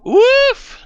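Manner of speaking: high loud shocked "woof"; whistling woof. Real, not acted. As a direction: blow out at the price. Reacts to fifteen hundred cost.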